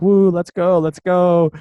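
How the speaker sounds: excited cheering. Retold or imitated. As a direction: imitate friends whooping to get going. Friends cheer the party plan.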